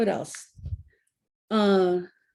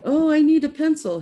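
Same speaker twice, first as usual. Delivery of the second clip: student asking; 7 semitones higher, 6 dB louder than usual. Retold or imitated. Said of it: "So it's like".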